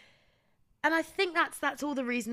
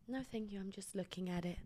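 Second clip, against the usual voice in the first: much softer, trying to get away; quiet curt reply; 11 dB quieter than usual. Retold or imitated. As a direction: murmur it to end the talk. Her own quiet reply in skit.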